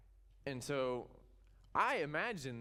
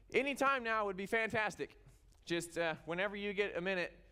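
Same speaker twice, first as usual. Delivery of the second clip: higher-pitched, comically polite plea; mock desperate pleading; 7 semitones higher than usual. Prophets nudge a silent Baal to answer, mock desperate pleading. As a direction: beg awkwardly, pitched up. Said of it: "sounds kinda desperate to me".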